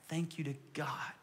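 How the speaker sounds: a hushed prayerful thanks; reverent whisper. Acted. Preacher whispers thanks to God before the congregation. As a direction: breathe it out softly in a whisper.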